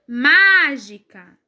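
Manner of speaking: MÁ of "mágica" stretched long and loud; stress on MÁ. Shown as a demonstration. Teacher showing pupils the strong syllable, MÁ stretched in "mágica". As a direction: lean hard on MÁ in "mágica". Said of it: "a sílaba mais forte é o má".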